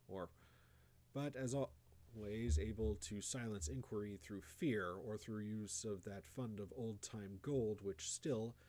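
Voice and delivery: plain reading voice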